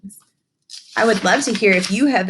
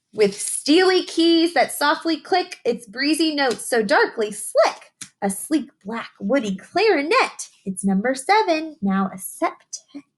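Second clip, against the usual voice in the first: slower, higher storybook read with a lilt; picture book reading; 7 semitones higher than usual. Acted. Teacher reading a rhyming book to young children, sing-song storytelling. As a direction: read the rhyme to the children, savouring each line.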